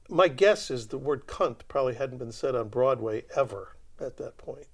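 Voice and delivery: ordinary talk, nothing marked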